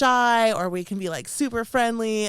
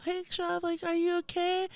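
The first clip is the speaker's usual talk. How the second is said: high, soft caring voice; a friend's high, hushed check-in; concerned friend; 11 semitones higher, 6 dB quieter than usual. Retold or imitated. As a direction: gently ask if she's okay, pitched up. Friend checks on anxious narrator.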